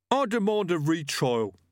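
high-pitched, put-on aggrieved voice; indignant butcher. Freshly executed killer protesting to Death, indignantly.

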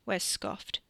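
plain reading voice

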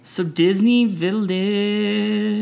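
slow, drawn-out and high; dramatic. Pretend. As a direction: announce it with theatrical flair. Dramatic drawn-out show topic.

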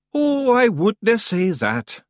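high, slow, tired Scottish sigh; a worn-out chief humouring him; weary Feegle. A narrator's reading. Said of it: "said Rob Anybody wearily".

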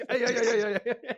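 loud, high, laughing taunt of "hey"; taunting yell. Retold or imitated. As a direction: jeer at him loudly and gleefully. Taunting enemy after shooting roof.

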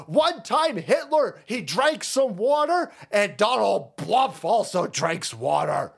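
high, strained mock yelling, drawn out; hammed-up impression of a raving, slow-witted politician; mock ranting. Host imitating the president comparing Trump to Hitler, mock ranting. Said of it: "this fucking dude goes".

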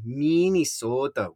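slow mock Minnesotan "Minnesota"; overdone Minnesotan accent. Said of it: "that's an overemphasis on what you actually sound like".

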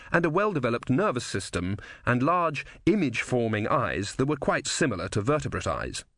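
ordinary delivery, nothing marked

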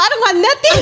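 high-pitched comic giving up, half laughing; mock exasperation. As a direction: imitate a fed-up customer. Retelling customer giving up, exasperated.

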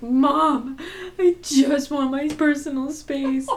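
tearful plea. Retold or imitated. Pleading to mom, retold.

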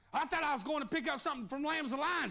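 rising, shouted preaching cadence. Acted. Preacher stirring up his congregation, rising shout. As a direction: preach it out with building fire. Preacher builds to full force.

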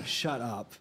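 usual speaking voice

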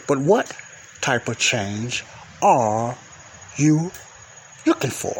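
"But what... type of change are... you... looking for?" broken by long pauses; emphatic question. Real, not acted. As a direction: stretch "are" and punch "you", pausing around each. Presses key question on listeners.

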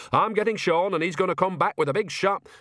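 high-pitched, blustering threat; furious old-woman character outburst; angry threat. Acted. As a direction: rattle out the threat indignantly, pitch up. Nanny storms off, threatening.

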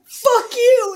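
high, loud final jab; parting insult. Retold or imitated. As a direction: spit it at him as you leave.

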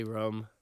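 "rum" for room, with a short vowel like drum; short-vowelled "rum"; own way of saying room. Shown as a demonstration. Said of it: "make fun of how I say".

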